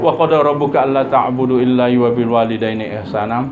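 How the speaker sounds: chanted Arabic verse; lightly intoned Quranic recitation. Acted.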